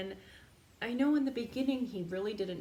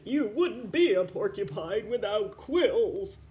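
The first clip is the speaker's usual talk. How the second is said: put-on firm, louder old uncle; emphatic uncle; 8 dB louder than usual. Uncle firmly reminding Elmore, emphatic. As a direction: state it plainly and firmly.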